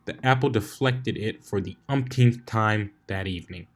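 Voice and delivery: ordinary delivery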